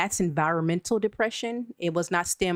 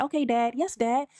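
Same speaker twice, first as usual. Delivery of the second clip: higher, rehearsed obedient replies; scripted people-pleasing politeness; robotic eager-to-please child; 6 semitones higher than usual. Retold or imitated. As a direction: reel off dutiful answers to dad. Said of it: "that's how I answered my dad".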